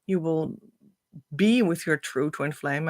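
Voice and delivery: ordinary delivery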